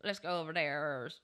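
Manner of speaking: half-hearted country accent, slightly low; badly faked country twang; country accent attempt. Shown as a demonstration. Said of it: "I can't do a country".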